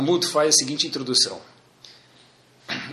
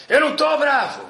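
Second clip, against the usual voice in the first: angry denial; 9 semitones higher, 3 dB louder than usual. Retold or imitated. Angry man slams door, denies.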